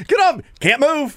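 very high mimicked back-and-forth; mock protest. Host replaying center and quarterback exchange, high mock protest.

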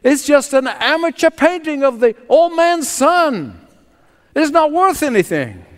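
mock a worthless painting from the back of the room; lightly voiced jeering bidder; obnoxious sneer. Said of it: "Another equally obnoxious voice said".

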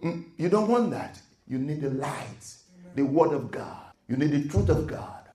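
normal talking voice

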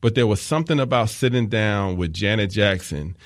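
usual speaking voice

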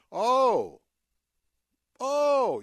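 high, loud mock Oh of realization; a sarcastic, loud Oh; mock surprise. Real, not acted. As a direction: pretend it just dawned on you.

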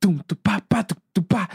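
vocal imitation of a drum beat; mouthed drum rhythm. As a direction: tap out the groove with syllables. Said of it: "o ritmo The Wonders".

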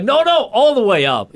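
hammed-up emcee pushing harder; mock concert host. Concert host pushing the crowd, high insistent call.

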